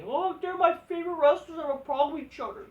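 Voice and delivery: high, whiny mocking fanboy; high mocking whine. Mocking Tony Khan as heartbroken fan.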